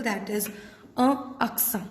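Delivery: usual speaking voice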